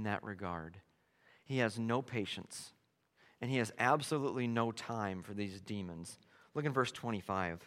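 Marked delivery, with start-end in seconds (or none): none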